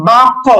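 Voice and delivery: "বাক্য" with rounded, o-coloured bounce; qalqalah tilted upward. Shown as a demonstration. Teacher shows the sound going upward, rounded heavy qalqalah. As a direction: round the mouth on the echo of "বাক্য". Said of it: "উচ্চারণটা হয় একটু উপরের দিকে".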